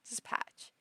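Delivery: "Dispatch" very quiet, P still aspirated. Shown as a demonstration. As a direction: try "Dispatch" under the breath to lessen the P. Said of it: "Put less emphasis on the P".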